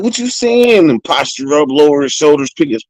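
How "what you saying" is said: mock challenge.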